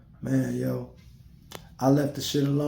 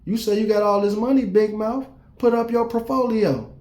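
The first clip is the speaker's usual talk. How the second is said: higher taunting call-out of Big Mouth; petty taunt; 7 semitones higher than usual. Pretend. Imagined reply to the girl, higher and taunting. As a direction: goad her mockingly. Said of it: "I coulda been".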